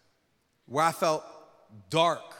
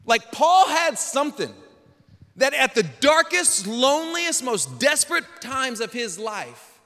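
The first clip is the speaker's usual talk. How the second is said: loud, high, building sermon delivery; full-force preaching; 4 semitones higher, 7 dB louder, slower than usual. Acted. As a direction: lift it to a shout of conviction.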